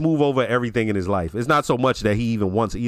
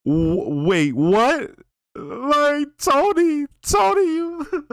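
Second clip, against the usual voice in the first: high-pitched mock disbelief; hammed-up flabbergasted plea; incredulous outburst; 13 semitones higher, much slower than usual. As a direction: pitch climbing up into a squeal of protest. I lost my shit.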